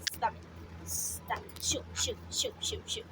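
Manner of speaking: breathy hushed pleading; whispered stop it. Real, not acted. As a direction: whisper urgently so the bird backs off. Hushed panic at pet.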